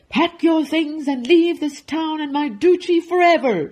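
high, loud, raging command; furious shout. Acted. Duke banishing the baker, angry shout. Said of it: "shouted the Duke".

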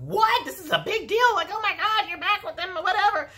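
very high-pitched imitation of shocked friends; mocking high voice, held up throughout; mock shocked squeal. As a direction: squeal it out in exaggerated disbelief. Mimics the group's shocked reaction.

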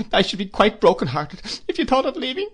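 high-pitched, tearful plea; overcome with emotion. A narrator's reading. Mother begs sister-in-law to stay, overcome. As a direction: break down as you beg. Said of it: "too much overcome to go on".

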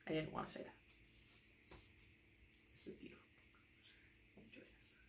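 whispered, reluctant; mock grudging whisper. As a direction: mutter it under your breath. Reader grudging forced lines.

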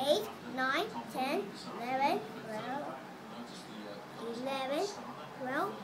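counting softly, fading away; soft, trailing count under the breath; quiet counting. Real, not acted. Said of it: "make it louder".

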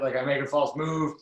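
ordinary talk

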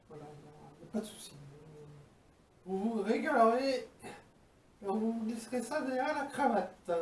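speaking between sips; muffled by drinking glass; glass at the lips. Real, not acted. Seen 1: Glass held at lips, sipping throughout labelled words; down by +3.1.

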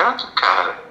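normal talking voice

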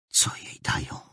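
hushed whispered question. A narrator's reading. Just asks Rutger in a whisper. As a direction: whisper so the guards won't hear. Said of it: "szepnął Just".

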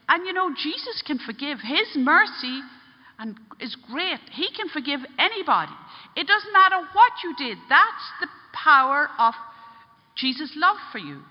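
high, loud, fervent assurance; preaching mercy emphatically; impassioned urging. Retold or imitated. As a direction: drive each phrase home with conviction. Priest preaches mercy to the man.

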